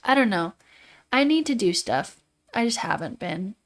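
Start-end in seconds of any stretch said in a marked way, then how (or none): none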